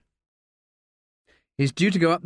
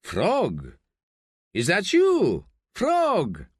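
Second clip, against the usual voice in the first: squinting surprise; 5 semitones higher, much slower than usual. Acted. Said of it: "Gutierrez squints and says".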